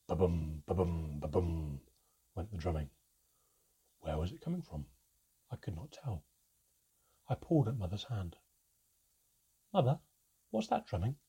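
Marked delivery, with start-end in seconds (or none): 0.1-1.8 drum imitation. A narrator's reading. Imitating drumming. 9.7-11.2 high small squeak of a question. A narrator's reading. A child asking Mother about the drumming, squeaked. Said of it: "I squeaked".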